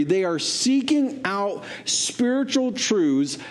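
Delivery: fervent high-pitched preaching. Acted. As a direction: preach it out at full force. Preaching climax, much higher pitch.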